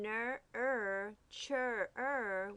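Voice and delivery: "ner, er, chur, er" in separate, held pieces; sounding out syllables. Shown as a demonstration. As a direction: isolate each "er" after its syllable. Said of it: "we hear er".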